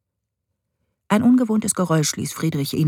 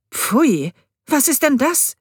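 high, loud recoil of disgust; 10 semitones higher, 3 dB louder, slower than usual. A narrator's reading. Stepmother recoiling at the bloody antler, disgusted. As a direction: recoil from the dirty thing.

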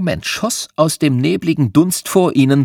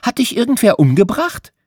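put-on high girl voice, blunt and innocent; young Madeline, high and curious; 11 semitones higher than usual. A narrator's reading. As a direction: ask it like a tactless child.

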